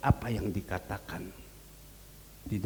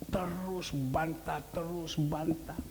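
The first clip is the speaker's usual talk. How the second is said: slow, soft repetition; drawn-out complaint; 5 semitones higher, 4 dB quieter, slower than usual. Retold or imitated. Explaining the son keeps arguing, drawn-out complaint. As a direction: stretch each "terus" wearily. Describes son's endless arguing.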